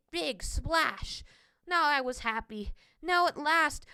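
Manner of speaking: plain reading voice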